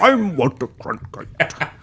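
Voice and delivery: slow, soft mock newsman voice; Walter Cronkite. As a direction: do a jokey, drawn-out anchorman impression. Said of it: "when you're like".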